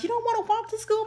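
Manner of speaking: high-pitched puzzled granny question; imitated grandmother. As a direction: ask in a high, put-on grandmother voice. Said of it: "my grandmother couldn't figure out".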